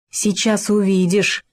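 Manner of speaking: ordinary delivery, nothing marked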